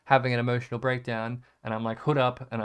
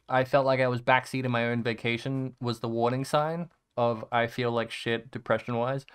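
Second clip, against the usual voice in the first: normal talking voice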